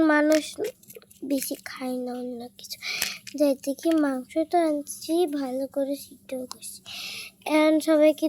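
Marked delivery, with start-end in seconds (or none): 1.2-2.8 secretive whisper so the guests don't hear; hushed, breathy aside; whispering while hiding the food. Pretend. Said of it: "এখন আস্তে আস্তে কথা বলতে হবে". 5.3-6.8 secretive whisper so the guests don't hear; hushed, breathy aside; whispering while hiding the food. Pretend. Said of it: "এখন আস্তে আস্তে কথা বলতে হবে".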